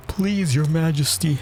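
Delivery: high-pitched pleading defendant; knave. A narrator's reading. Knave appealing to his majesty, high pleading voice. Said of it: "said the knave".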